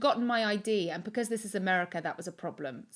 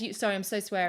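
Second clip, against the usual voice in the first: ordinary talk, nothing marked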